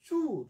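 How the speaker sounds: চুর with "oo" in place of চোর; regional u for o. Retold or imitated. Teacher imitating home-district speech, o said as u. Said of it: "আমাদের সব হলো উ".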